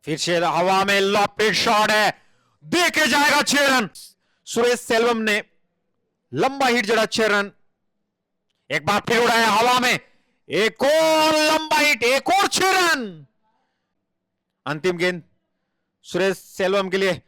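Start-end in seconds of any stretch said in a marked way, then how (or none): 0.4-7.5 high, slow call of a six; excited cricket commentary. Acted. 8.9-13.3 high, very slow drawn-out six call; excited cricket commentary. Acted.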